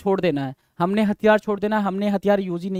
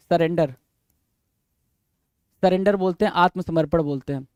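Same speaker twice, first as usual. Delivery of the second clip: usual speaking voice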